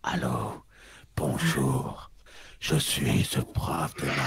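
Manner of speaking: low, slow breathy ASMR voice; mock ASMR murmur, deep and slow; right in your ear. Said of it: "préparez-vous pour une séance d'A S M R".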